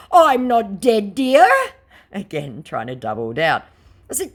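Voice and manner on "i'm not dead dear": high, loud and slow put-on voice; hammed-up fake celebrity. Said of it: "To which he said".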